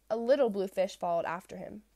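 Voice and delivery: ordinary delivery, nothing marked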